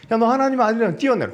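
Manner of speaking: loud, fast mocking dare; a jeering devil shouting a quick challenge; taunting dare.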